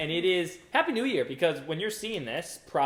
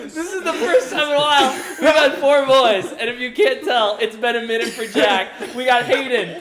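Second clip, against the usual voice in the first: laughing; 7 semitones higher, 9 dB louder than usual. Real, not acted. Giggly intro after laughing fit.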